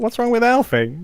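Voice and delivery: high-pitched mock concern; mock puzzlement. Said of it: "It's like".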